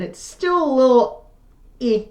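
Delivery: cracking, recovering voice. Real, not acted. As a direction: let the voice crack a little. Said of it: "lost my voice for, like, a month".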